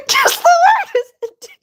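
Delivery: real giggling fit, words squeezed out; laughing hard. Real, not acted. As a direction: laugh through the words till they break. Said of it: "I sound like I'm crying when I'm laughing".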